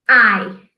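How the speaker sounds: I said as English eye, /ai/; pronounce I. Shown as a demonstration. Teacher showing how I sounds to pupils. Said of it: "a pronúncia é".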